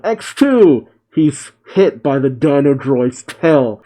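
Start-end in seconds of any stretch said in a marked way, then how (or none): 0.0-0.9 mock battle yell. Trunks calls out a move to Goten, mock yell. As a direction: yell it lightly as a retold battle cry. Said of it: "Trunks yells out".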